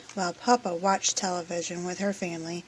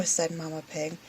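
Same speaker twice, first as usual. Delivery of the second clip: ordinary delivery, nothing marked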